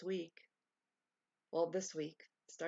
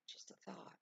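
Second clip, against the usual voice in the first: whisper; 12 dB quieter than usual. Shown as a demonstration.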